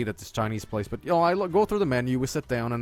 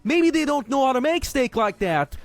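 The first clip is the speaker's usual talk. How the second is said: much higher, whiny placating imitation of a father; mock dad voice; 11 semitones higher, 3 dB louder than usual. Father calming his angry son Joel, in a much higher mocking voice. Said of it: "He interjects and goes".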